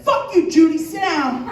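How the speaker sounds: loud, high order to back off; comic outburst at a rival mom; mock angry yell. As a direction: yell down another parent, hammed-up. Acted snap at bully's mom.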